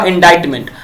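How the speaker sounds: ordinary talk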